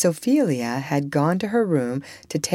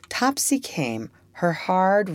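ordinary delivery, nothing marked

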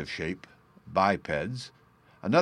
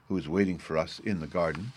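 ordinary delivery, nothing marked

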